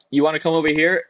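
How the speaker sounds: normal talking voice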